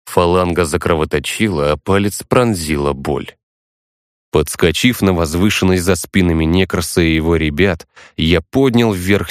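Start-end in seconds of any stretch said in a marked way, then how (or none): none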